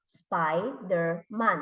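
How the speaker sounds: slow "Spider-Man" in three beats; syllable by syllable. Shown as a demonstration. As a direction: sound out SPI, DER, MAN in "Spider-Man". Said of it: "what are the sounds in the word".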